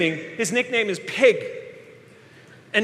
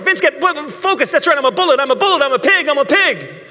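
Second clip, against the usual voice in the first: louder, urgent pep talk; loud self-talk; 4 semitones higher, 5 dB louder than usual. Retold or imitated. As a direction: psych yourself up out loud. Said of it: "talking out loud to yourself".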